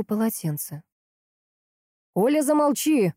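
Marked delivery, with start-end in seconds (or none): 2.1-3.1 higher, stern command; harsh order. A narrator's reading. Husband silences panicking wife, harsh order. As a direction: order her firmly to stop. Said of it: "приказал жёстко Протасов".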